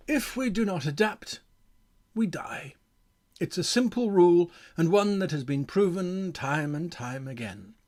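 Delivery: measured, weighty announcement to the audience; mock-solemn address. Game designer warning old-edition players, mock-solemn address.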